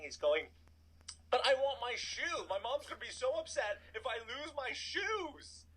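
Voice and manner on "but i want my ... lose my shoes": high-pitched mock wail of a child; crying kid. Kid stuck without his shoe, mock crying. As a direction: imitate an upset kid whining, pitched way up. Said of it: "this kid starts crying".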